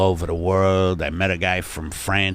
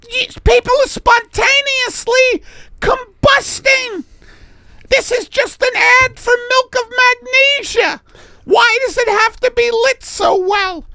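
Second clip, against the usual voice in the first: very high, loud falsetto shriek; hammed-up shrill protest; exasperated model Millie; 9 dB louder than usual. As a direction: squeal in outraged disbelief.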